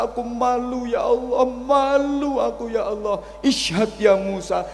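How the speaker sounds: high, loud tearful plea to God; weeping prayer. Retold or imitated. Repentant sinner crying out to God, weeping loudly. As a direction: wail the repentance, voice lifted high and pleading.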